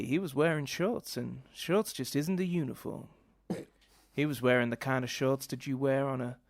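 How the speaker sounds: theatrical Southern American accent, as if in a play; hammed-up stage American accent; annoying American accent. Shown as a demonstration. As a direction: ramble self-importantly in a badly faked American accent. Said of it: "how much more annoying than just doing the accent".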